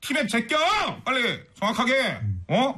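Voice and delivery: hammed-up urging; mock scolding. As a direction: lecture the company bosses in pretend exasperation. Mock scolding of navigation company bosses.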